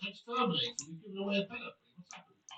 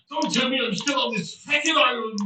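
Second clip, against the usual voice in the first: loud, grumpy outburst; Father Jack impression; 2 semitones higher, 6 dB louder than usual. Streamer continuing a requested character bit, loud Father Jack voice. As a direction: bellow it in disgruntled disbelief. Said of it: "done a Father Jack impression".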